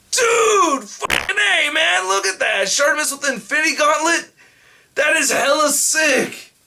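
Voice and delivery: thrilled cries of pure fanboy joy; ecstatic shouting. Real, not acted. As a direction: lose it completely over the gift. Overjoyed at the unboxed figure.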